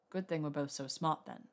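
low-pitched dry remark; deadpan, understated male character voice. A narrator's reading. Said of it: "he said dryly".

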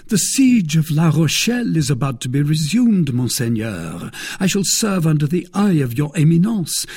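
lightly voiced young guardsman, politely declining; D'Artagnan, deferential. A narrator's reading.

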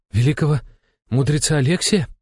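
startled rise in pitch; taken aback. A narrator's reading. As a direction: caught off guard, voice jumps up. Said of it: "опешил он".

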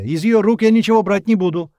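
high, loud, adamant refusal; categorical shout. A narrator's reading. Zhenka refuses to take anything from her, shouting. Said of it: "категорично закричал Женька".

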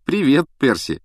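lightly amused hello; holding back laughter. A narrator's reading. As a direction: keep the giggle in. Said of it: "сдерживая смех".

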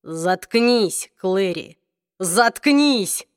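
usual speaking voice

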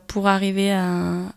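ordinary talk

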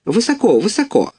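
fussy hens cackling in alarm; high clucky hen voices; hens clucking. A narrator's reading. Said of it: "кокали куры".

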